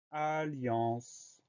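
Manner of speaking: "alliance" softly stretched over its long syllables; soft, slow, lengthened "alliance"; drawn out. Shown as a demonstration. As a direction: sound out "alliance" slowly for dictation. Teacher sounds out new word.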